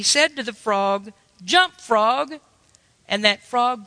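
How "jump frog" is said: commanding call. Retold or imitated. Scientist commands frog to jump.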